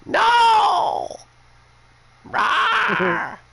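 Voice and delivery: very high, loud monstrous shriek; witch screech. Retold or imitated. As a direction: roar it like a furious witch. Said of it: "I'm exaggerating a little bit".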